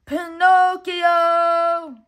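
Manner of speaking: loud, high call, very slow and drawn out; worried father's stretched-out call; long calling out. A narrator's reading. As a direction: call far into the night for a lost son. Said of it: "Geppetto called out until his throat was sore".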